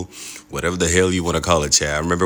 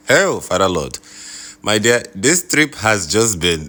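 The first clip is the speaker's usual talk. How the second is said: exasperated; 5 semitones higher, 4 dB louder than usual. Real, not acted. Power cut, exasperated outburst.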